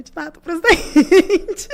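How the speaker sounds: high, slow, giggly answer; bashful laughing thanks; laughing reply. Retold or imitated. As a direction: squeak it out through a laugh. Retold reply to Lula, laughing.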